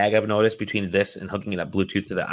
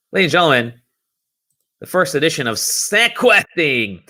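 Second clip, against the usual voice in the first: announcer voice; 5 semitones higher, 3 dB louder than usual. Acted. Show intro announcement.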